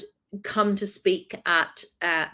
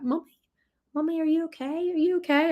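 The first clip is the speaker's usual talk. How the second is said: put-on worried little kids; 7 semitones higher than usual. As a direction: gently ask like a small child. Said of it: "they were like".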